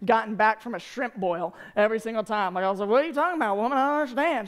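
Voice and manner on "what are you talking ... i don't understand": put-on Cajun accent. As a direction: imitate coming home talking Cajun. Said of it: "they are Cajun as the day is long".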